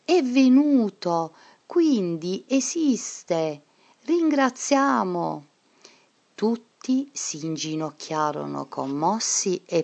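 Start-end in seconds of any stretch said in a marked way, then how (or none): 0.0-1.3 high, louder cry of wonder; joyful exclamation. A narrator's reading. Angela waking as from a dream, high joyful cry. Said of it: "esclamò". 1.7-5.4 slow, heartfelt words of thanks; awed joy. A narrator's reading. Angela inviting the class to give thanks, slow and moved. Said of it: "esclamò".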